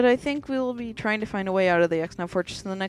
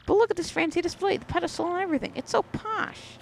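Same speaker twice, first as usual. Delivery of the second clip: much higher, swooning over the display; gushing posh voice; 10 semitones higher than usual. Acted. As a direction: coo at the fancy pedestal. Character gushes over fancy display.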